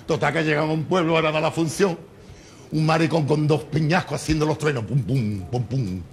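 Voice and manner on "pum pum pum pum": mock thunder. Imitating thunder rocks.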